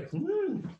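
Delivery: high, soft pondering hum; Yoda. Acted. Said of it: "I've been working on my Yoda".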